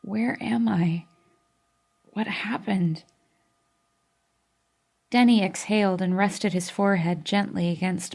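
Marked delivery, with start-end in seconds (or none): 0.0-3.0 slow raw whisper; scratchy weak voice. Acted. Kiera wakes in the hospital, scratchy and weak. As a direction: barely get the words out. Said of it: "My voice was scratchy and my throat raw".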